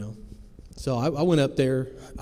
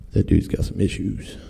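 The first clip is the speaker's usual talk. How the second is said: low, scoffing aside; dismissive passerby; 4 semitones lower, 7 dB louder than usual. Retold or imitated. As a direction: brush him off while walking past. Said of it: "walking away like".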